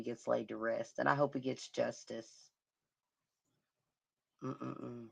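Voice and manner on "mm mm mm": ordinary delivery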